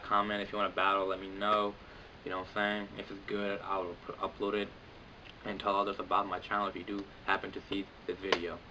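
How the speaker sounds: ordinary talk, nothing marked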